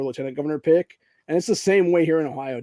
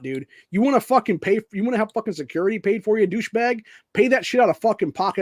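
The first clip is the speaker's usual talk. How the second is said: higher, louder angry tirade that spits the insults; furious rant; 3 semitones higher, 3 dB louder than usual. Real, not acted. Ranting at the lieutenant governor over his security bill, furious shouting. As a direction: tear into him, shouting the insults.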